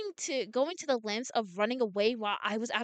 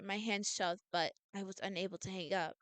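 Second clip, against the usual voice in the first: ordinary delivery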